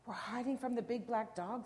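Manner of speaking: ordinary delivery, nothing marked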